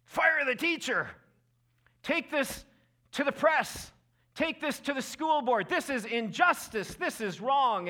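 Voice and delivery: high, loud indignant shouting; fired-up relatives. Retold or imitated. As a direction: rant like outraged family on the chat. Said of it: "some pretty fired up relatives".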